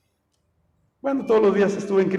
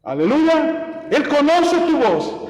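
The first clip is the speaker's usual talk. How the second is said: raised, fervent preacher's voice, a touch higher; preaching; 3 semitones higher than usual. Acted. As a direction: preach it out to the congregation. (predicación fervorosa, con la voz un poco más alta)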